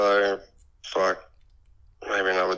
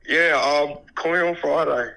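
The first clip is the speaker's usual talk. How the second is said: ordinary delivery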